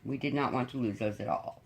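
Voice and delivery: ordinary talk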